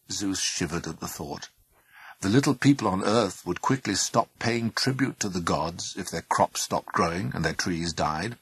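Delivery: ordinary delivery, nothing marked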